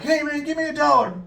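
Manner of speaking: high-pitched imitation of a demanding stranger; mock shouting. Man knocking on a car window, high mock shout. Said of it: "I can hear him shouting from inside the store".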